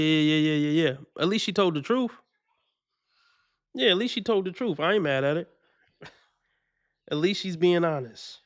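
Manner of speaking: ordinary talk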